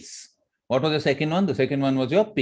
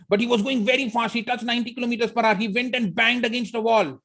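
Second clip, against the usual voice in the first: fast; 9 semitones higher than usual. Shown as a demonstration. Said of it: "when I was talking about the drama of the auto driver going fast, I went fast".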